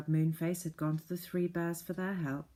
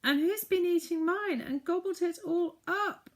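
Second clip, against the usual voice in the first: very high, louder tearful squeak; weeping baby bear; 13 semitones higher, 4 dB louder than usual. Acted. Baby bear with an empty plate, high tearful voice. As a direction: wail it like a tiny upset cub. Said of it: "wept the baby bear".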